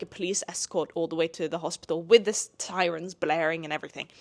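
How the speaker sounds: ordinary delivery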